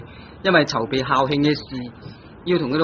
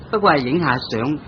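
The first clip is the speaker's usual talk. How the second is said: normal talking voice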